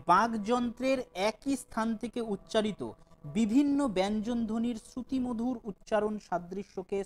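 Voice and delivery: slow, deliberate definition for notes; teacher dictating a definition slowly; slow dictation. Shown as a demonstration. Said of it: "আবারও বলছি".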